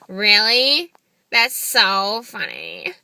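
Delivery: lightly hammed-up reply, loud then drawn out; exaggerated phone voice. Shown as a demonstration. Woman reacting to his comment on her voice, exaggerated. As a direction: stretch it slowly into a laugh. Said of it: "I pictured your voice sound differently".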